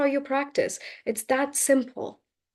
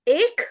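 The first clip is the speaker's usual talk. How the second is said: "Ache" pitched up as a question; questioning echo; 4 semitones higher than usual. Retold or imitated. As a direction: echo "Ache" back with a high rising query. Teacher questions student's wrong answer.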